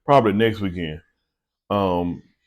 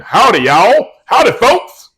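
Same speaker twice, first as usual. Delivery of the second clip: much higher, louder hammed-up Southern greeting; big loud Southern welcome to everyone; Southern howdy; 13 semitones higher, 9 dB louder, slower than usual. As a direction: call it out like a cheery Southern host. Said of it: "a strong Southern thing".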